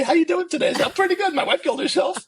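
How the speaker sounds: high, loud mock-chirpy small talk; silly. Retelling a market greeting to the host, silly high voice. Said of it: "I'm being silly".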